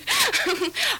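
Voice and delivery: high gleeful laugh. Real, not acted. Student laughs after taunting rival list, high gleeful laugh. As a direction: laugh at your own jab.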